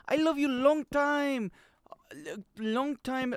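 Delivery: put-on high, drawn-out Vietnamese accent. Streamer quoting Full Metal Jacket from memory, high Vietnamese accent. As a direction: imitate a film character in a Vietnamese accent. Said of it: "That's why I could do the accent".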